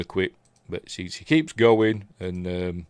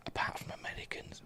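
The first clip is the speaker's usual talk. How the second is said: whispered aside; 18 dB quieter, faster than usual. Real, not acted. Whispered aside about baseball.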